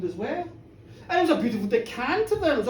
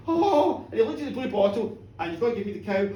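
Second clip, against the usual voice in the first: usual speaking voice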